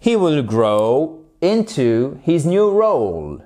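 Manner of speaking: slow, careful repetition; careful teacher model sentence; slow repeat. Shown as a demonstration. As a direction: say it again slowly for learners. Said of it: "Let me repeat".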